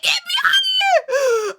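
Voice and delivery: high, loud crying plea; crying shout. Retold or imitated. Begging on the jail phone to be let out, loud crying wail. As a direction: wail it through tears, begging. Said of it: "I started crying".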